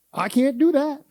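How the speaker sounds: curt imitated refusal; quick excuse. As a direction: toss off the excuse. Mimicking church members' excuses.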